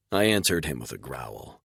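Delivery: plain reading voice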